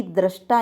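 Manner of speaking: दृष्टा as "drash-taa"; correct pronunciation. Shown as a demonstration. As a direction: say दृष्टा with an a after "dr". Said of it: "शुद्ध शब्द है".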